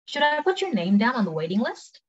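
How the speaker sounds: quick, linked-up model question; quick take. Shown as a demonstration. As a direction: ask it at conversational speed. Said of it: "cepet sama pelan".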